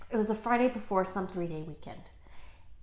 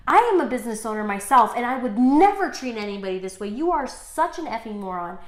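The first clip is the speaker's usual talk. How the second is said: loud, indignant retold rant; heated mock tirade, easing toward the end; losing it; 7 dB louder than usual. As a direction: re-enact telling someone off, punching the first words. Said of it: "completely losing it on this guy".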